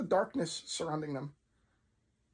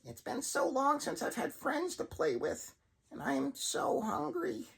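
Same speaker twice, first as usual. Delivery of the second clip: sinister puppet; 7 semitones higher, 3 dB quieter than usual. Acted. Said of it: "voice noticeably began to deepen and echo".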